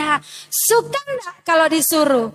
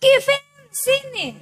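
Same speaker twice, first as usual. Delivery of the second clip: put-on high, slow, sing-song call to a child; mother calling child; 9 semitones higher than usual. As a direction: call the kid over like a mum. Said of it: "anak-anak kalau baru panggil". (suara ibu memanggil anak, tinggi dan lambat)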